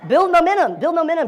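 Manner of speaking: loud, high rallying call; rallying command. Real, not acted. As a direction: belt it out like a coach's slogan. Coach drives home his slogan.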